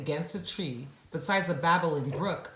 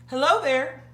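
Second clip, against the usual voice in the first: calling out; 10 semitones higher, 8 dB louder than usual. Acted. Boy greets ostrich.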